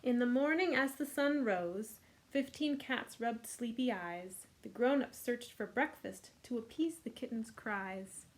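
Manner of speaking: ordinary delivery, nothing marked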